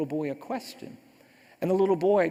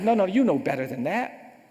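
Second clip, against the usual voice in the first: firm, raised mother's reprimand; scolding mother; 3 semitones higher, 6 dB louder than usual. Retold or imitated. Mother corrects her son for laughing at a boy, scolding.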